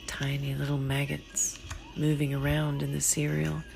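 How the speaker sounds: usual speaking voice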